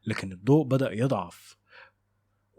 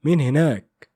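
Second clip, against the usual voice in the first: loud, higher narrated shout; shouted challenge; 5 semitones higher, 8 dB louder than usual. A narrator's reading. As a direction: call out sharply to a figure down the corridor. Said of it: "العسكري سيد صرخ". (صرخة تنادي بصوت عالي)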